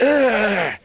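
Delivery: high pained grunt; loud high yelp of pain. A narrator's reading. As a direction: yelp from the pinch. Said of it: "Raccoon yelped".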